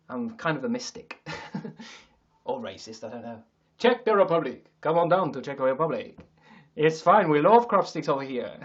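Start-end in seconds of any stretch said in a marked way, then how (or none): 3.8-8.6 loud, hammed-up Czech accent; mock Czech accent. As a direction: sell it loudly in a fake Czech accent. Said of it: "what accent is Czech".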